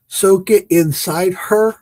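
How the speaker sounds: mishearing the dad's advice, slow and baffled; slow, puzzled mishearing. Retold or imitated. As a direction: drag out inside her slowly, baffled. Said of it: "I'm like".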